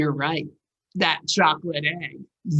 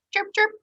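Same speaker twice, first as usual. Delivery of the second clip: high chirping bird call. Acted. A bird calls from the tree, high chirp.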